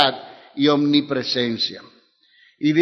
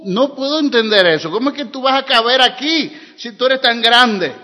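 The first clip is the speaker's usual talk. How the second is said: high, loud, impassioned pulpit voice; preaching at full force, voicing King Solomon's astonished prayer; fervent preaching; 4 semitones higher, 5 dB louder than usual. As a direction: pour out Solomon's awe at God's vastness.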